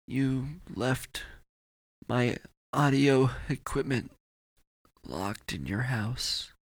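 slow, halting last words; weak, dying effort. Acted. Dying Matt telling Brett about the equipment, slow and labored. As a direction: drag the words out between long gaps.

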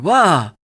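loud, high startled cry; sudden loud groan of shock. A narrator's reading. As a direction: yelp out in alarm. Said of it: "Chance groaned".

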